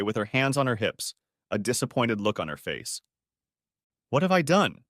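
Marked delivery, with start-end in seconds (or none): 4.1-4.8 annoyed. Acted. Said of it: "in an annoyed tone".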